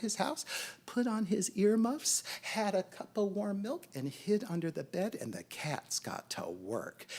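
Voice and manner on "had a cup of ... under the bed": ordinary delivery, nothing marked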